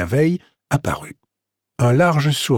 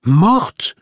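higher, rising shocked question; startled cry; 7 semitones higher, 3 dB louder than usual. A narrator's reading. Catherine learning of the woman's death, startled cry. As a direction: cry out in disbelief, without much volume. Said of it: "s'écria Catherine".

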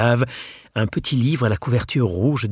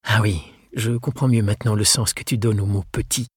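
ordinary talk

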